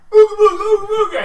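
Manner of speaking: high, loud, fast mock chant; mock spellcasting. Kobold mocks the player's spellcasting, high and loud. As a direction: mimic them waving a spell. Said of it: "You went".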